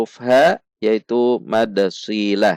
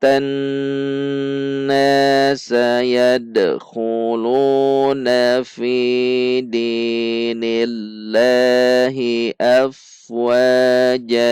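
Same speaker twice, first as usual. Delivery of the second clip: Quran recitation. Shown as a demonstration. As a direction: recite it measured, stretching each long syllable evenly.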